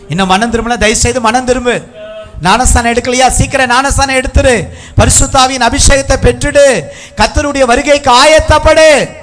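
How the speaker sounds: high preaching that keeps building; urgent exhortation. Real, not acted. Preacher urging youth to repent and prepare, climbing insistence. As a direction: drive each command harder to a peak.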